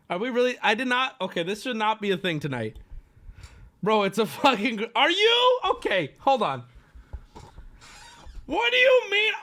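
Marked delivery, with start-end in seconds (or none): 4.9-5.6 high, loud disbelief; can't believe what chat says; incredulous. Real, not acted. 8.4-9.3 incredulous. Real, not acted. Arguing gorilla vs bear.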